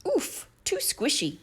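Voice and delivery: put-on a fussy girl finding the chair too hard; pouty high ouch; Goldilocks complaining. A narrator's reading. Goldilocks tries bed.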